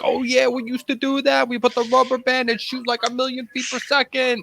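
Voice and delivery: high, squeaky imitation of a buddy; high-pitched buddy voice; quoting Richie. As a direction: squeak it out like a hyped friend. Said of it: "And he's like".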